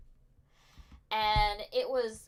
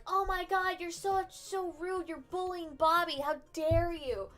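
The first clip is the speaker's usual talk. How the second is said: higher, slow, mock outraged girls; offended girls; 8 semitones higher, slower than usual. Girls scolding the guys for bullying Bobby, mock outraged. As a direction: ham up the scandalized gasp. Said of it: "And the girls are like".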